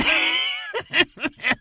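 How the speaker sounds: a loud burst of laughter. Real, not acted. Laughing at her own joke.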